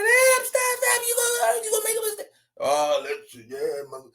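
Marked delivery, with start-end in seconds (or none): 0.0-2.3 mock screaming wife. Host imitating the wife begging, high mock scream. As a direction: ham up a panicked wife, pitched high. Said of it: "She's screaming".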